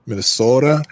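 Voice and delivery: exaggerated Minnesota accent.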